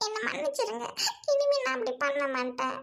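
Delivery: sobbing out a promise to the fiancé; crying apology. Acted. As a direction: beg forgiveness through tears. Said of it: "அழாத".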